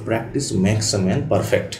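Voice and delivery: very slow, each word laid out; a saying read slowly for weight; slow proverb. Shown as a demonstration. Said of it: "আমরা একটা কথা বলি".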